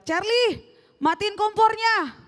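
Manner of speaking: high mock yell from another room; mom yelling from bedroom. Mom yells at her son to turn off the stove, high mock yell. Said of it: "terus dia dari kamar teriak".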